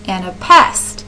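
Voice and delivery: "And a pest" with two light syllables, then stressed PEST; stressed last syllable. Shown as a demonstration. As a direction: land the weight on "pest" in "And a pest". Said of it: "if you're stressing the syllables".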